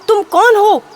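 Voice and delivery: acted frightened man's shout. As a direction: yell the question in alarm. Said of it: "Ramu भी चिल्लाने लगा".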